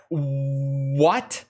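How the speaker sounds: low, drawn-out "what" swooping up at the end; mock disbelief, slow rising question; baffled reaction. Streamer imitating commenters hearing the name, mock bafflement. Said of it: "they were like".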